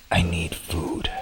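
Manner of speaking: low gruff Snake voice. Pretend. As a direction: growl it low and quiet like Snake. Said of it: "I can do a solid Snake voice".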